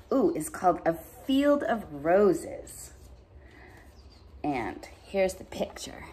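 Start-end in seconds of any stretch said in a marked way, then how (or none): 4.4-6.0 whispered aside. Real, not acted. Unvoiced aside.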